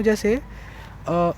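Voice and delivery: ordinary talk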